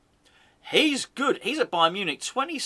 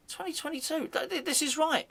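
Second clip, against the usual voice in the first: excited; 11 semitones higher, 6 dB quieter than usual. Real, not acted. Excited at contract date.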